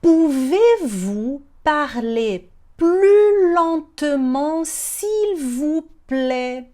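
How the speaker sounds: very slow, stretched syllables; slow teaching demo. Shown as a demonstration. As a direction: model it word by word. Teacher models phrase slowly. (demonstração bem devagar)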